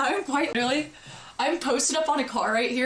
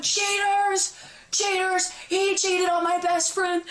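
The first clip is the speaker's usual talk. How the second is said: high, sustained mock scream; retold screeching; 7 semitones higher, slower than usual. Dangled by her friend and still accusing, high mock screech. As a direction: re-enact wailing accusations while being hauled off, pitched up. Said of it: "now I'm screeching".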